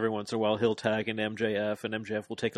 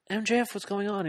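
mock concern; 11 semitones higher, faster than usual. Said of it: "He's like".

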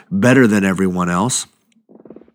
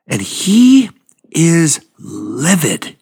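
emphatic indignation; 6 semitones higher, much slower than usual. Real, not acted. Preacher drawing out "livid" for the congregation. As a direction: pitch jumps high on "he", then "livid" drawn out slow. Preacher stressing brother's anger.

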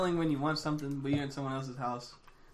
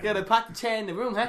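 put-on louder, joking Boston accent; 5 semitones higher, 4 dB louder than usual. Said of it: "What about Boston?".

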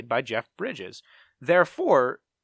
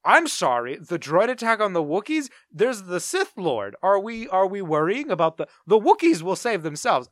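incredulous; 6 semitones higher, 6 dB louder than usual. Retold or imitated. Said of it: "And it's like".

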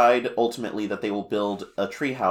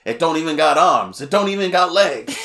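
high, loud blustering complaint; hammed-up angry king scolding a salesman; lightly voiced cartoon king griping, laughing at the end; King Dedede voice; 5 semitones higher, 6 dB louder than usual. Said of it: "D D D says all of these things".

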